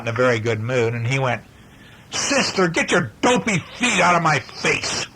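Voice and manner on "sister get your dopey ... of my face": higher, angry shout at sister; yelling brother. Acted. Grumpy brother yelling at his sister. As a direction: snap at her like a grumpy big brother. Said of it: "And he yelled at her".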